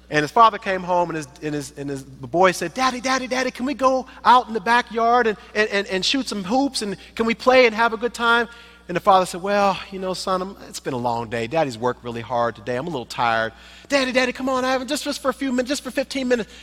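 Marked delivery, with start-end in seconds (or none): 2.7-8.5 put-on high, pleading child voice; eager little boy. Little boy begging his dad to play, high and eager. 9.4-13.5 low, soft weary dad voice; tired father. Pretend. Father after work telling son no, low and weary. 13.9-16.5 put-on high, pleading child voice; eager little boy. Little boy begging his dad to play, high and eager.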